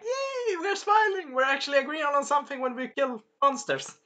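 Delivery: high, squealed little celebration; mock cheery. The two fighters bond over killing monsters, mock-gleeful high voice. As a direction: pretend glee, high and softening.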